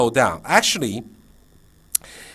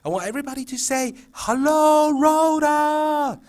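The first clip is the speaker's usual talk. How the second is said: fake scream; 13 semitones higher, 5 dB louder than usual. Shown as a demonstration. Teacher cueing kids to answer, fake shouting. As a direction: send a shout signal in a squealed fake voice. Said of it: "I'm using my fake vocal".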